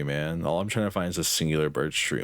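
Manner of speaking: usual speaking voice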